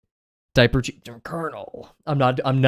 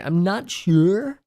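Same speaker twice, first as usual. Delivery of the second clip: YouTuber voice; 7 semitones higher, much slower than usual. Shown as a demonstration. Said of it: "YouTuber voice".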